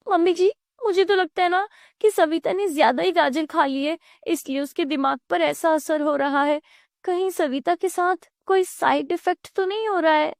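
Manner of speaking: high-pitched younger-woman character; speculating daughter-in-law. Acted. Daughter-in-law suggests carrots affected Savita, high and concerned.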